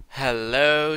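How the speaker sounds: a loud delighted greeting to the drop; loud pleased hello. Real, not acted. Player greets a good drop with a loud pleased hello. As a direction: call out hello to the new item.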